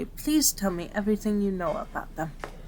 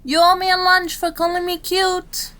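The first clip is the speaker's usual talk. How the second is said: high, loud shout after a colleague; indignant call; 9 semitones higher, 6 dB louder than usual. Acted. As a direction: call out the demand in mock outrage. Calls after departing colleague.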